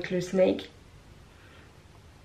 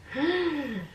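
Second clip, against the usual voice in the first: pained gasp; 7 semitones higher than usual. Retold or imitated. Pain reaction at the dentist.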